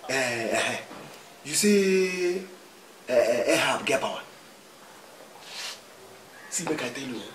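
ordinary talk

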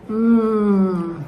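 ordinary talk

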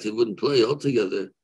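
normal talking voice

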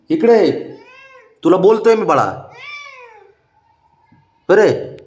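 sharp scolding to make him look; calling the pet over, firmly. Real, not acted.

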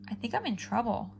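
plain reading voice

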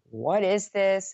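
loud, slow demand; retold angry yell. Wife confronting her husband over drugs, yelling.

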